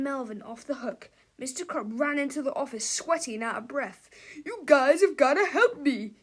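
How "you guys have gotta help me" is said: loud, high, desperate plea with a laugh in it; panicked cry. A narrator's reading. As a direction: beg for help in a frantic voice. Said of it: "he cried".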